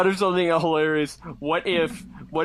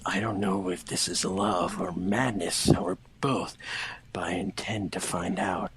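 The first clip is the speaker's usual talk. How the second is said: much lower, softer moody voice; lightly brooding Shadow; 12 semitones lower, 4 dB quieter than usual. Acted. Reading Shadow's thoughts in a low, brooding voice. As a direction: play the dark loner confessing.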